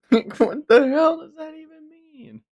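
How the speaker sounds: very high, strained cry of disbelief; incredulous outcry. Real, not acted. Streamer shrieking at a confusing chat message, incredulous. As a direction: shriek what does that even mean.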